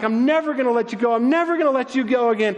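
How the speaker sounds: high, fast, gushing promise; clinging joy. Acted. Woman clinging to her risen teacher, overjoyed. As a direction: rush it out high, holding on tight.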